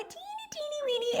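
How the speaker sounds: very high, soft little squeak of a voice; tiny voice. Pretend. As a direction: make the words sound tiny, high and quiet. Mimics tininess with tiny voice.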